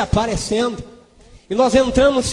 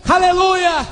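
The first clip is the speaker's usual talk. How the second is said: high, ringing hallelujah; exclaimed praise; 6 semitones higher, slower than usual. Real, not acted. Preacher praising God before the congregation, exclaimed praise. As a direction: cry out praise on a high pitch.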